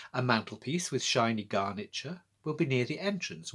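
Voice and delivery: ordinary delivery, nothing marked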